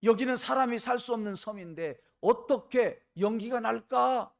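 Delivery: high, loud puzzled soldier voice; soldiers wondering aloud. Acted. Soldiers on the ship puzzled by smoke on the island, loud and high. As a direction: call it out, baffled. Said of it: "그들은 말했습니다".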